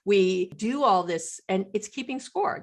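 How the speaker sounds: ordinary delivery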